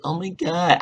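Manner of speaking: high-pitched mock-annoying girl; grating girlish "oh my god" impression; imitating Starla. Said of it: "I hate her fucking voice".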